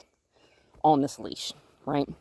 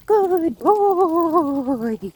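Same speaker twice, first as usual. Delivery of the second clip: excited; 10 semitones higher, 5 dB louder than usual. Real, not acted. High drawn praise after run.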